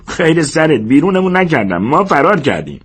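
high-pitched, fed-up correction; disgusted. A narrator's reading. George corrects Lennie about fleeing Weed, disgusted. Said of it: "جورج با بیزاری گفت".

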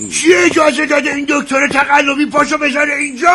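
loud, high indignant challenge; angry demand. Acted. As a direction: demand an answer in outrage. Mirza angered by fake doctor.

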